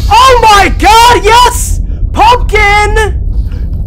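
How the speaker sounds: loud, high, elated yelling; real elated shouting; triumphant shout. Real, not acted. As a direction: cheer as you finally make it. Triumph after escaping.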